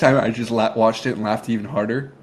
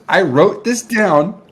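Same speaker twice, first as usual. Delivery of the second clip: normal talking voice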